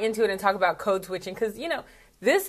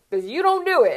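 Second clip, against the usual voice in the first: teasing friend; 8 semitones higher, 3 dB louder than usual. Retold or imitated. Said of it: "He's like".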